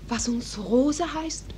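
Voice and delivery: ordinary delivery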